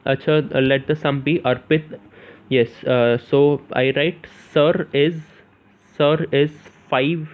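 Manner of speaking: usual speaking voice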